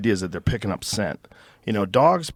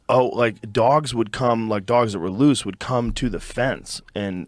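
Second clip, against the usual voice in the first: usual speaking voice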